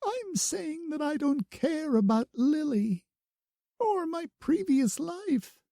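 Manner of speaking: high, earnest through tears; tearful insistence. A narrator's reading. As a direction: plead that only now matters. Bloom pleading, crying.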